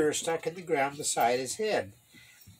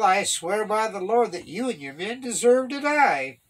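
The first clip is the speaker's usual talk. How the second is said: loud, raised-pitch rebuke; taunting challenge, voice raised; 5 semitones higher, 6 dB louder than usual. A narrator's reading. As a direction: call out the accusation across a distance. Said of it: "David taunted".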